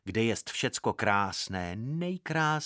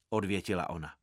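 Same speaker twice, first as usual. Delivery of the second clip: usual speaking voice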